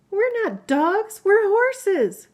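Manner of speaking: big high gasp, then slow indignant words; dramatic gasp. Shown as a demonstration. Mother showing her child how to gasp, slow high gasp. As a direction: gasp loudly as shown, then protest. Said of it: "you say it like this".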